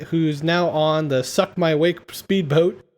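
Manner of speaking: normal talking voice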